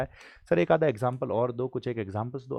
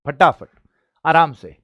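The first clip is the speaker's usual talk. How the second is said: loud, higher call to hurry; louder push, then ease off; 4 semitones higher, 13 dB louder than usual. Real, not acted. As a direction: shout to speed them up. Teacher urging then easing.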